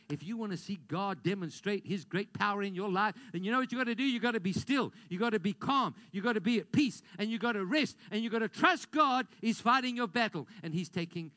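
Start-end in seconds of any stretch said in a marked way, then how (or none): 3.3-10.5 rising exhortation. Acted.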